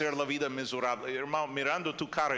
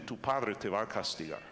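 usual speaking voice

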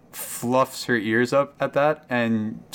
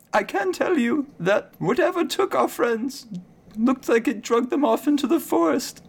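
much higher, anxious cow voice; frightened cow Claire; 13 semitones higher than usual. Acted. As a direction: squeak it out like a scared cow that saw nothing. Cow Claire keeps talking.

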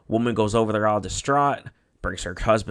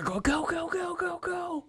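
very high, panicked "go, go, go"; mock frantic getaway cries; imitating panicked yelling in the car; frantic urging; 5 dB quieter, slower than usual. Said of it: "fucking yelling in the car".

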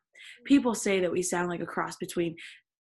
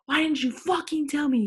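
higher, hammed-up indignant yell; acted shout; 7 semitones higher, 4 dB louder than usual. A narrator's reading. Reader voices Draco confronting Ebony, acted shout. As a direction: demand it in a mock-furious raised voice. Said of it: "he shouted".

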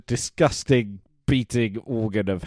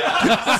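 high unvoiced laugh; 13 semitones higher than usual. Real, not acted. Laugh after joke.

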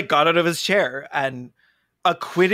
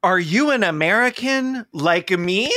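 mock closing argument; 7 semitones higher, 4 dB louder than usual. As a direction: play a blustering defendant before the jury. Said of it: "would be like".

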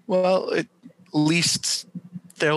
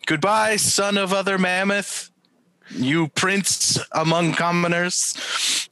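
grand farewell; 8 semitones higher, slower than usual. Acted. Farewell speech to mammoth.

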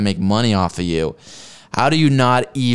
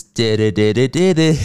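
louder sing-song nonsense syllables; mocking babble; 4 dB louder than usual. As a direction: babble to mock her.

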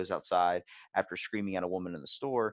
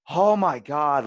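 loud, high exasperated "Oh my God"; impatient public complaint; exasperated mock groan; 4 semitones higher, 12 dB louder, much slower than usual. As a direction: sigh it out like a fed-up shopper. Impatient customer in store.

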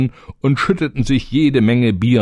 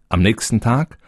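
ordinary delivery

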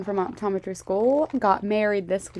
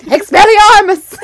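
shouted Harry Potter spell; 12 semitones higher, 15 dB louder, slower than usual. Pretend. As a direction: yell it like casting a spell.

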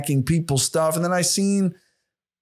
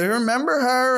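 put-on girlfriend's question; 10 semitones higher than usual. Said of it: "my girlfriend's showing me".

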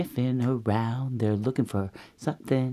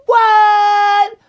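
high, loud, stretched-out shriek; shocked parent bears; 12 dB louder than usual. Acted. Parent bears hear of broken chair, shocked shriek. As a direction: shout it in hammed-up disbelief. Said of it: "And Mama and Papa Bear go".

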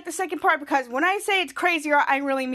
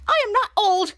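higher, louder protest; offended Captain Man; 6 semitones higher, 3 dB louder than usual. Retold or imitated. Captain Man insulted about age, offended. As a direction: bristle at the insult. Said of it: "offended by that".

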